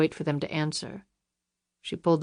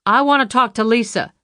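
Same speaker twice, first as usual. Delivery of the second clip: louder, firm, stress on want; firm demand; 6 semitones higher, 7 dB louder than usual. A narrator's reading. Luann demands Jackson put Lisa on, louder. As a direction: demand to speak to her daughter.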